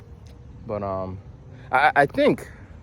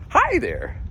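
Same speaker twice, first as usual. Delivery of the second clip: high-pitched robocall greeting; automated caller; 11 semitones higher than usual. Retold or imitated. Automated caller greeting him, high chirpy imitation. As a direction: mimic a perky recorded salesperson. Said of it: "I get the automated ones".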